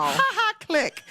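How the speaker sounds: high mock laugh, then cut off; mock dismissive. Host retelling hanging up on the caller, mock dismissive. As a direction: imitate brushing off a prank caller. Retold hanging up on supposed prank.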